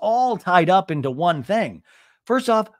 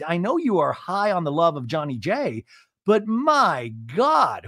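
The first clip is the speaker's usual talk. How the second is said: normal talking voice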